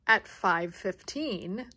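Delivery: ordinary delivery, nothing marked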